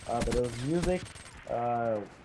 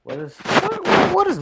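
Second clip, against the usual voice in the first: exasperated high outburst; 8 dB louder than usual. Real, not acted.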